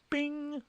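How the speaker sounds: a sudden, very high "bing!"; ding like a success chime; bing sound effect. Acted. Proud dad marking his parenting win, a high chime "bing".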